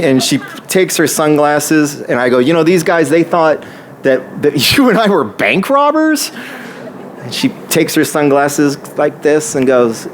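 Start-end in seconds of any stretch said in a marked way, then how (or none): 2.4-6.3 high, disbelieving question; retold incredulity. Storyteller to friend about being mistaken for robbers, incredulous. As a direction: act out being amazed at the mix-up.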